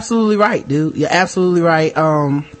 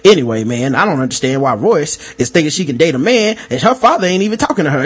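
ordinary delivery

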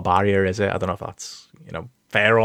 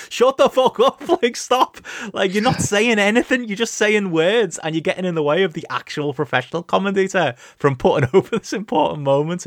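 exasperated rant; 9 semitones higher than usual. Retold or imitated. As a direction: vent it, half laughing, at the commentator. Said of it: "It was just like".

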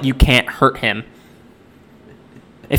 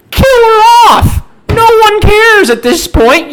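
real exasperated yelling, much higher and louder; frustrated shout; 7 dB louder than usual. Real, not acted. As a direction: shout it out in pure fed-up frustration. Ranting at the movie's choices.

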